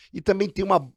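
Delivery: ordinary talk, nothing marked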